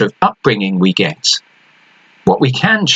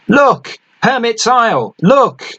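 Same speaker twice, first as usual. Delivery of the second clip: terrified shout; 12 semitones higher than usual. A narrator's reading. Lookout shouting down to the deck in terror. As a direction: yell it down from the crow's nest in terror. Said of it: "the terrified shout from above".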